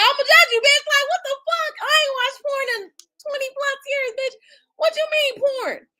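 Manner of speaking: high, indignant mock rant; hammed-up outrage at being judged. The judged person snapping back at the accuser, high and indignant. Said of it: "And they start judging your ass".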